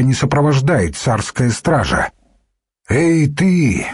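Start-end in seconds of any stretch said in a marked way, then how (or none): 2.9-3.9 put-on mild raised call to a young nun; shout. A narrator's reading. Official calling sharply to a young nun, mild shout. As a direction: call out sharply, a touch higher. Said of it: "крикнул он молоденькой монашке".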